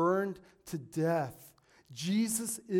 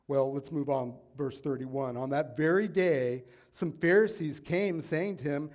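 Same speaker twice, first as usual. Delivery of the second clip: ordinary talk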